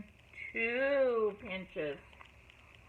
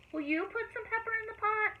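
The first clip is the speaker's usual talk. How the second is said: calling out; 10 semitones higher than usual. A narrator's reading. Calling to children.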